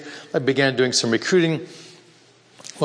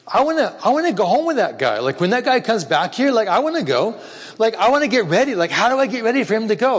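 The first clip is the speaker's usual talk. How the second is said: high, eager voice of a man longing to go home; 8 semitones higher than usual. Retold or imitated. Man longing for Jesus to return, high and eager. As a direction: voice him aching to be taken home.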